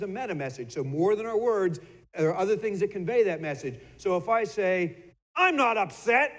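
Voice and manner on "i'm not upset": high, loud, clipped "I'm not upset"; tense denial. Shown as a demonstration. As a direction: snap it out, plainly upset. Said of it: "I noticed I even spit when I said that".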